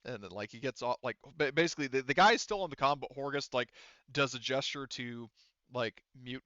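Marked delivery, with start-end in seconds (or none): none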